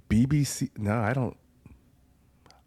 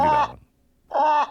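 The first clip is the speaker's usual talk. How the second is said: high voice; 7 dB louder, much slower than usual. Real, not acted. Boasting he can do goose.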